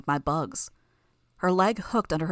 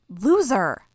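shouting; 4 semitones higher, 7 dB louder than usual. Acted. Sophia's outburst at guard.

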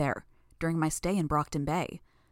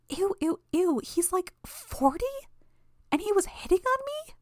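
put-on much higher, squeamish teen disgust; disgusted girl. A narrator's reading. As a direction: squeal it out grossed out. Imp disgusted, very high voice.

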